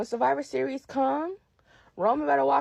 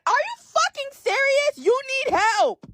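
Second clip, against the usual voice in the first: outraged high yell; 13 semitones higher, 5 dB louder than usual. Real, not acted. Outraged at Roman's apology.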